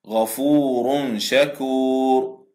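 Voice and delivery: غفور شكور very slow, the tanween hidden in a nasal hum before the شين; drawn-out غفور شكور with held ghunna; nasal ikhfa demo. Shown as a demonstration. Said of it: "تنوين بالضم جاء بعده حرف الشين".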